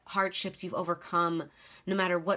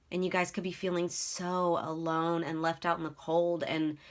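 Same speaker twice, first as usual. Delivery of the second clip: ordinary talk, nothing marked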